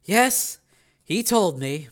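flat, measured reply; said flatly. A narrator's reading. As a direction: admit it without expression. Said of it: "she said flatly".

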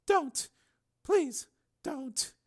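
high female plea; pleading woman. Acted. Susan unable to look at Steele, pleading. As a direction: beg him in a high voice.

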